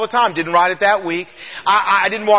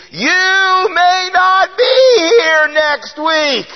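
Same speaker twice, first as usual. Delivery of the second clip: high, slow, drawn-out shouted warning; retold scream; 9 semitones higher, 4 dB louder, much slower than usual. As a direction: call it out across a crowded street, slow and loud. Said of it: "he screams at me".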